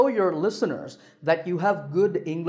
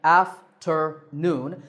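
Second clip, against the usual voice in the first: afternoon split slowly into af-ter-noon; slow, separate beats in afternoon; syllable by syllable; much slower than usual. Shown as a demonstration. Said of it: "Three syllables again".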